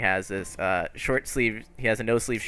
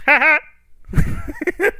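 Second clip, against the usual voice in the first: high, loud two-beat "ha ha"; character's cackle; 7 dB louder than usual. Acted. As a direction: burst out in a manic showman's laugh. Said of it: "He goes".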